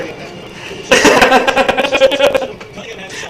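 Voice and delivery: high, drawn-out laughing fit; long real laugh; real cackle. Real, not acted. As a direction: burst out cackling at your joke. Cracking up at own joke.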